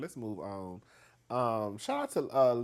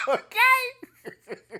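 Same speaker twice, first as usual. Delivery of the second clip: laughing; 11 dB louder than usual. Real, not acted. Laughing at own joke.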